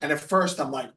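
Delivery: normal talking voice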